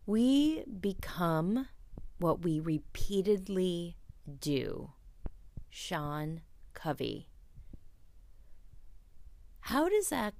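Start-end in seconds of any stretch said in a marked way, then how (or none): 0.1-4.9 quote reading. Acted.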